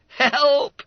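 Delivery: high, loud mock alarm; shrieked. Schoolmate seeing the postal orders, shrieking. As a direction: shriek it in comic shock. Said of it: "shrieked Peter Todd".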